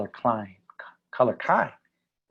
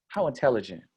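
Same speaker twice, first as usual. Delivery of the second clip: normal talking voice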